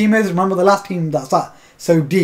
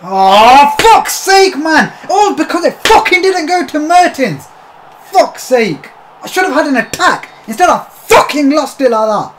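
real angry shouting, higher and louder; furious rant; 8 semitones higher, 6 dB louder than usual. Real, not acted. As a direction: vent the rage at the game. Raging at the game.

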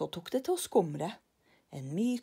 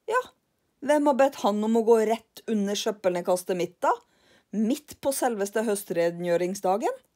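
louder, huffy retort; indignant Karlsson; 3 semitones higher, 7 dB louder than usual. Acted. Karlsson shrugs off lillebror's worry, louder. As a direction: blame the man airily.

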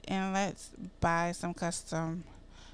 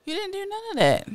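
much higher, fast, shrieking repeat; outraged squeal; 12 semitones higher, 4 dB louder, faster than usual. Pretend. Scolding the partner again, much higher and faster. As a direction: shoot way up in outrage.

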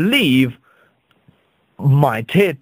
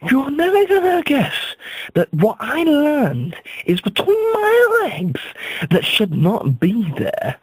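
anxious son; 12 semitones higher than usual. Retold or imitated. Son voiced pleading to father.

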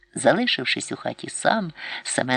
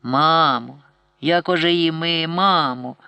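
bored prompting; 3 semitones higher, 4 dB louder, slower than usual. A narrator's reading. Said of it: "нудьгуючи".

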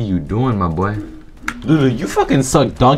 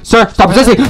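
loud, higher barked cop order; cop command; 11 semitones higher, 7 dB louder than usual. Pretend. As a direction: order the suspect to stop, loud but held. Cop yelling at suspect in RP.

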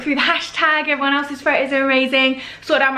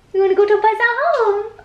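pet voice; 9 semitones higher, 3 dB louder than usual. Real, not acted. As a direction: coo "We wanna go to Pesa Home" up high to the puppy. Cooing the plan to the dog.

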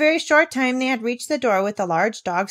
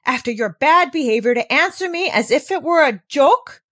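loud, higher angry outburst; raised voice; 6 semitones higher, 7 dB louder than usual. Acted. Governess exploding at the girl's cheek, loud and higher. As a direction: let the anger burst out. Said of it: "With raised voice she exclaimed".

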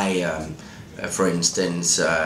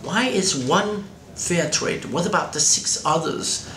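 higher-pitched curious questioning; 8 semitones higher than usual. Retold or imitated. Journalist wondering about chocolate bars in the supermarket, curious. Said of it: "I was just curious".

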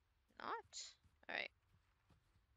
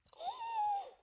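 sharp gasp; 3 dB louder than usual. Real, not acted. Sudden scare in game.

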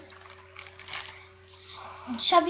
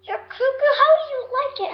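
high voice to dog; 12 semitones higher, 6 dB louder than usual. Real, not acted. Talking to her dog.

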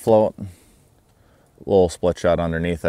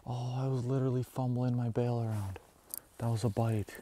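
hushed; 6 semitones higher, 8 dB quieter than usual. Real, not acted. Angler hushed after missed bite.

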